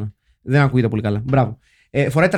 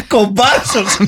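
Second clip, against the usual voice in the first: laughing; 4 semitones higher, much slower than usual. Real, not acted. Mocking Connery's Bond role.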